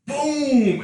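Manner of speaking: loud high shout of boom; explosive boom. Acted. Big loud boom for the orgasm climax. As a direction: bark it out like an explosion.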